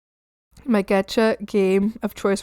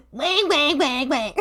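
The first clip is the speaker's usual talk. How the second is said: higher, silly nasalized croaks; squabbling frog noises; 4 semitones higher, 4 dB quieter than usual. Retold or imitated. So many frogs in the swamp, mock squabbling. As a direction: imitate bickering frogs. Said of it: "there's so many frogs in swamp".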